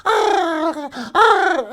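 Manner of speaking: high, loud hammed-up dinosaur growl; dinosaur roar. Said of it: "I'll do the".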